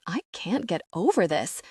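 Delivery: ordinary delivery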